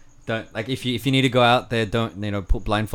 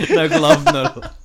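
squeaky high laughing chant; 3 dB louder, slower than usual. Real, not acted. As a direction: repeat it cracking up with laughter. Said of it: "If you're gonna do it with a monkey".

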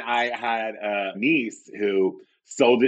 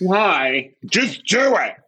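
mock exasperation; 9 semitones higher, much slower than usual. Cousins and own reply acted out.

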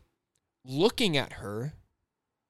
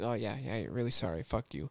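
low, softer mock agreement; sarcastic aside; 4 semitones lower, 9 dB quieter than usual. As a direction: grumble a sarcastic retort under your breath. Mock reply to customers' apology.

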